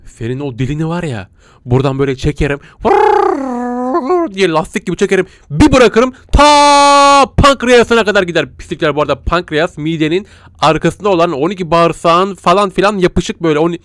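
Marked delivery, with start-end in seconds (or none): 2.9-8.5 high, loud, slow mock threat. Gamer hamming up a threat against the creature. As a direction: ham up a cartoon threat.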